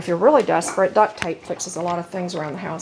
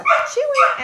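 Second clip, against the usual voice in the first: playful excitement. Real, not acted. Owner playfully asking her dog where the chews are, high excited baby talk. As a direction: tease the dog with a squeaky, excited search.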